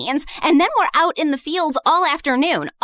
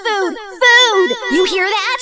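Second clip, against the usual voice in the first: food, food pushed louder and higher; mocking shout; 10 semitones higher, 3 dB louder, slower than usual. Jessica shouting food at Huna, mocking. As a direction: shout it at her like she can't understand.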